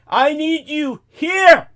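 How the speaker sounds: high, loud, drawn-out demand; insistent demand. Retold or imitated. Caller demanding the cab driver come over, insistent demand. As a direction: call it out slowly, leaning on each word.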